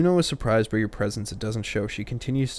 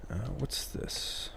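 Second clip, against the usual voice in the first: whisper; 12 dB quieter, much slower than usual. Real, not acted. Whispered puzzled aside.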